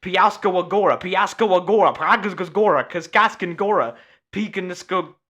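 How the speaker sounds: "Piaskowgora" slipping into tongue-tied nonsense; tongue-twister repetition. Shown as a demonstration. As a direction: rattle off "Piaskowgora" until it garbles. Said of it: "Say that five times".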